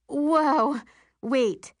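high, loud, alarmed interruption; suddenly nervous. A narrator's reading. Narrator halts friends planning a poster, suddenly nervous. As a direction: cut in with sudden nerves. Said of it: "suddenly nervous".

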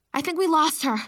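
breathless and panting; out of breath. A narrator's reading. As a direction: gasp it out after running. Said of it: "I said out of breath".

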